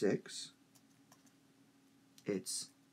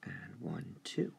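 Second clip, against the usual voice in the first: whispered one, two; teacher whispering the beat count; 2 semitones lower, 6 dB quieter, slower than usual. Shown as a demonstration. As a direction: count softly under the breath. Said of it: "counting the beats".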